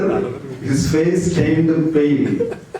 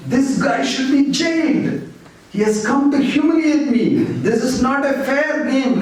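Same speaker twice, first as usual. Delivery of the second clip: higher, indignant mock shouting; retold outburst, voiced higher; 9 semitones higher than usual. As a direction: rant like a sore loser, pitched up. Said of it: "Like that he started shouting".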